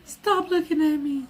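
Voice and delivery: [name] impression. Streamer mocks a viewer's voice, high [name] impression. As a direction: squeak it out like a shy pop star. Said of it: "you sound like [name]".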